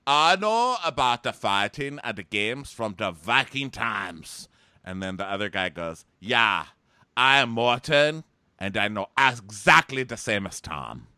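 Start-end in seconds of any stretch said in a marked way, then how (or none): 0.1-4.5 loud, slow mock Scandinavian accent. Viking games host introducing himself, mock Scandinavian accent. 6.2-11.1 loud, slow hammed-up Nordic accent; mock Scandinavian accent. Second Viking host backing up the first, mock Scandinavian accent.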